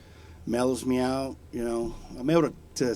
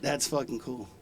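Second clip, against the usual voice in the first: ordinary talk, nothing marked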